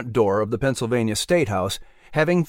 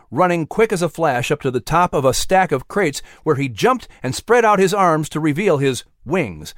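ordinary delivery

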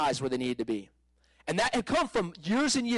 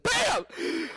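high, gleeful "Bam!" as the arm shoots out; exclaimed bam; 13 semitones higher than usual. Retold or imitated. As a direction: pop the sound out high with triumph. Showing arm snapping straight.

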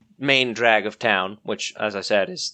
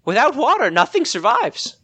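higher, flustered shopkeeper woman; Dee the shopkeeper; 11 semitones higher than usual. Acted. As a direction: play the startled village shopkeeper.

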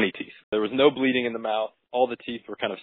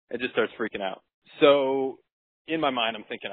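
normal talking voice

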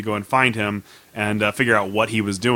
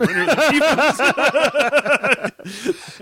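laughing; much slower than usual. Real, not acted.

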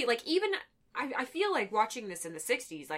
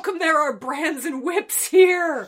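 alarmed; 5 semitones higher, 14 dB louder, slower than usual. Retold or imitated. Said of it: "and then I was like".

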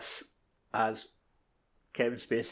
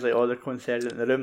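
normal talking voice

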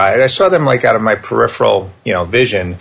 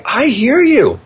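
higher, sincere and understanding; telling strict parents he understands, sincere and higher; sincere empathy; 12 semitones higher, slower than usual. Retold or imitated. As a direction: meet them where they are, earnestly. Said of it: "with authenticity".

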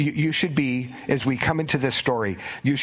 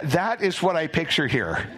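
normal talking voice

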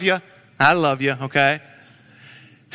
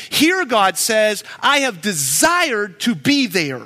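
slow, weight on desired; slow emphatic; 2 semitones higher, slower than usual. Acted. Preacher repeating the verse to the church, slow. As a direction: lean into desired, unhurried.

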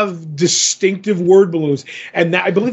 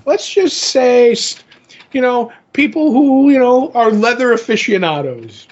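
coy hinting; 6 semitones higher than usual. Real, not acted. Coyly hinting about leather club.

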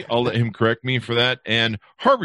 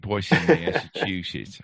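bad Boston accent; 3 semitones lower, 3 dB quieter than usual. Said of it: "I'm not even doing it right".

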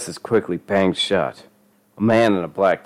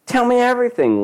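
very high, distressed plea; tearful demand; 4 dB louder than usual. A narrator's reading. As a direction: beg in a high upset voice. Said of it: "Lisa was crying now".

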